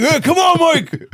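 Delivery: very high, loud cheering yell; mock cheer. The stepdad yelling at Mike to kiss, high and loud. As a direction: cheer him on from the sidelines.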